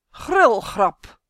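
loud, ominous graveyard voice; sepulchral voice. A narrator's reading. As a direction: boom it out as from the tomb. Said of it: "met een grafstem". (luide grafstem)

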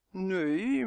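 drawn-out, humming no; hesitant, mumbled no; murmured. A narrator's reading. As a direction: let the word trail into a murmur. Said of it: "murmelde Dummy".

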